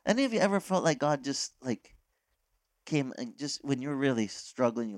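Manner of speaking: ordinary talk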